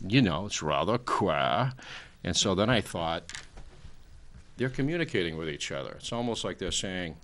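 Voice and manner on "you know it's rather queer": slow, refined British accent imitating James Mason. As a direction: drawl it like a British film actor, slowly. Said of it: "they all sound like the British actor, uh, James Mason".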